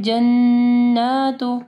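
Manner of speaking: जन्नातु with a long humming n and long aa; slow chanted जन्नातु, holding the double n; doubled noon, long vowel. Shown as a demonstration. Teacher models the word for students, drawn-out ghunnah. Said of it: "नून मुशद्दद है और खड़ी ज़बर है".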